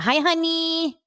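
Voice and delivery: high, lilting mock of a husband's hi honey; imitating Mauricio. Husband greeting his wife, lightly imitated, high and sugary. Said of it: "he talks like that".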